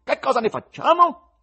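high, loud scornful tenor. Acted. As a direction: sneer at the presenter.

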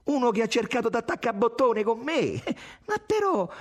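high-pitched, anxious admission; almost trembling. A narrator's reading. Irene admits a flirtation to Tommaso, almost trembling. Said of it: "quasi tremando".

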